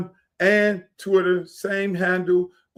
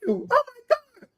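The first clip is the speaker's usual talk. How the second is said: mock fan gush; 5 dB quieter than usual. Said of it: "all the people call me up".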